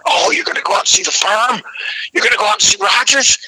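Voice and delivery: high, excited rising questions; mock excitement. Telling [name] about seeing the Firm, excited high questions. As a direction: gush with disbelief at their luck.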